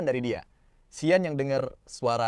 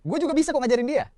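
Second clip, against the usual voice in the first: much higher, louder defiant retort; 13 semitones higher, 6 dB louder, faster than usual. Acted. Sian challenging Zhou Anqian, high and loud defiance. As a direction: fire back, refusing to lose. Sian not backing down to rival.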